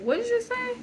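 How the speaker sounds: high-pitched surprised question, imitating her; imitated startled "what?". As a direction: quote her taken-aback question way up high. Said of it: "surprise, like".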